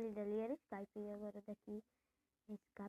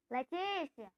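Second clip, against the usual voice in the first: calling out; 5 semitones higher, 7 dB louder, slower than usual. Real, not acted. Calling cousin by name.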